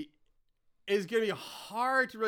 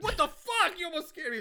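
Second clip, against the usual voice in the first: high-pitched startled swearing; mock panicked scolding; startled outburst; 13 semitones higher, faster than usual. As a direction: act out getting a fright, voice jumping high. Acts out startled Cecil with gun.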